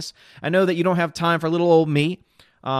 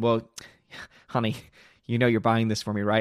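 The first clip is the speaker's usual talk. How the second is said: imitating wife; 5 semitones lower than usual. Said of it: "she looked at me and she said".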